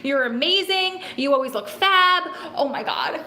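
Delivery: high-pitched delighted gushing with a laugh creeping in; flustered, touched gushing. Real, not acted. Streamer flustered by viewers' compliments, gushing high. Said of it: "making me cry and blush".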